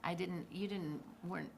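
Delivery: usual speaking voice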